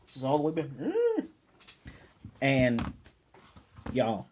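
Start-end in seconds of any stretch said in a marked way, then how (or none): 0.8-1.2 very high held squeal; imitated cry. Retelling the jerk reeling all the way back, high mock squeal. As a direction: mimic a man squealing high.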